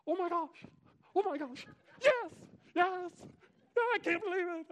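very high, loud ecstatic squeals; exceedingly glad. Acted. Preacher playing the king overjoyed that Daniel survived, bonkers with excitement. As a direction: go bonkers with joy, voice shooting way up. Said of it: "jumping up and down out of excitement".

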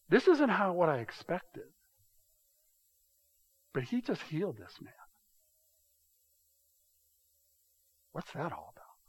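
puzzled wonder. Retold or imitated. Pastor voices a confused onlooker, fading puzzlement. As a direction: trail off, unsure what to make of it. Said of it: "There's another voice you could speak it with".

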